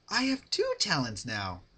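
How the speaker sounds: a hint of proud sing-song; chirpy Pinkie. Pinkie boasting to Rarity, lightly chirpy.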